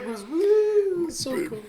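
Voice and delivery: a high whoop then a high gushing line; whooping excitement. Real, not acted. Co-host whooping about the minus forty-six cold. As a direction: let out a real whoop of delight.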